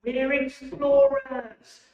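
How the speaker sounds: drawn-out, high-pitched call-out; long, rousing group cheer; shouting out. Shown as a demonstration. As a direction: shout it out slowly for the audience to join in. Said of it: "I hope you're shouting out".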